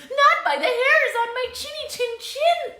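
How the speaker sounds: high squeaky piglet; little pig voice. Acted. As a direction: squeak the refusal like a small pig. Pig refuses the wolf.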